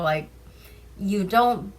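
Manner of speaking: plain reading voice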